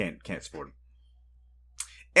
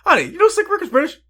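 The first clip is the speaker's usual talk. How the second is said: high-pitched imitation of an astonished question; exaggerated high retold surprise; mock amazed husband; 4 dB louder than usual. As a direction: call it out to your wife in disbelief. Retells husband's excited question to wife.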